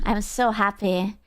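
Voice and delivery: ordinary talk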